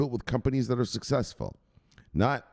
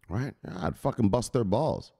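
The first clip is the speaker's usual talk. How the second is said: ordinary talk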